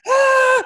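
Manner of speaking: loud, very high mock yelp; startled yelp. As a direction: re-enact a sudden shriek mid-call. Re-enacts startled yelp on call.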